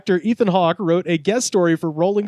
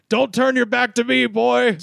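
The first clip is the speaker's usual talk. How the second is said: higher, mock menacing warning; hammed-up tough threat; 8 semitones higher than usual. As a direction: play a veteran squaring up, half in jest. Retold backstage quarrel, Chris voiced.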